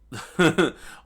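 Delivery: high, loud burst of laughter; sudden amused laugh; real laugh. Real, not acted. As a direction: laugh out at how freaky it is. Teller laughs at freaky image.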